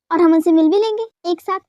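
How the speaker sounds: ordinary delivery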